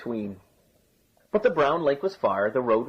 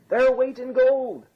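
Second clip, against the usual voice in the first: high, drawn-out market call; hawker's cry; 13 semitones higher, 3 dB louder, slower than usual. A narrator's reading. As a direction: sing it out slowly to passers-by. Hawker crying the price.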